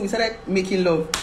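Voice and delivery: usual speaking voice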